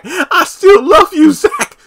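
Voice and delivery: very high, loud, hammed-up wail; mock tearful declaration. Karen telling Zach she still loves him, mock tearful wail. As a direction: squeal it out in overdone lovesick anguish.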